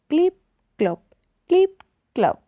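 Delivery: short, spaced clip-clops; donkey hoofbeats. A narrator's reading. The little donkey walks along, imitated hoofbeats. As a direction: voice each hoof step separately, clip up, clop down. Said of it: "se oían los pasos del burrito".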